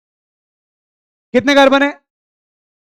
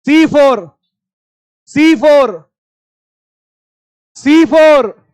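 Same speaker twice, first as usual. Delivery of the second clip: C FOUR hammered, louder each time; stressed repetition; 4 dB louder, much slower than usual. Shown as a demonstration. Teacher drilling the formula to students, stressed. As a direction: drill the answer home. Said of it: "repeats for emphasis".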